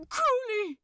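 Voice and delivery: high, loud call across the park; crying out. A narrator's reading. Angel calling out to the demon he lost. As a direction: shout his name in desperate hope. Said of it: "he cried".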